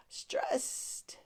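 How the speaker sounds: breathy stressed whisper. Shown as a demonstration. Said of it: "That's not what I sounded like".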